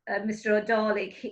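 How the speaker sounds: ordinary delivery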